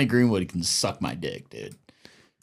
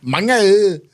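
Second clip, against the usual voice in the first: high, bouncy fake Vietnamese accent; sing-song mock Vietnamese accent, much higher; 11 semitones higher, much slower than usual. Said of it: "You gotta be bouncy".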